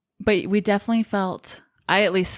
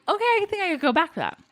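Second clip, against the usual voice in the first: higher, fast mock reconsidering; imitating a convinced taster; 9 semitones higher, faster than usual. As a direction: play the taster won over. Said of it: "you're like".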